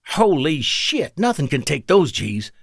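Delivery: high-pitched amazement, first words drawn out; thunderstruck. A narrator's reading. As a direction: stretch out the awe, pitched high.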